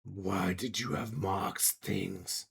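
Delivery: slow monster interrogation; suspicious creature. Acted. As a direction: drag the question out like a beast. Creature questions the player's character.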